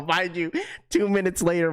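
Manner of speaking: normal talking voice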